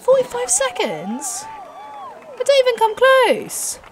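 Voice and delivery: very high, drawn-out wail; outraged disbelief. Real, not acted. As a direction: wail it slowly in dismay at the result. Dismay at own race time.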